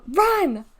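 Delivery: loud, high urgent command; yelled. A narrator's reading. Girl tells her brother to flee Norma, yelled. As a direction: yell for him to flee. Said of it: "she yelled".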